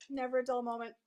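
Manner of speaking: usual speaking voice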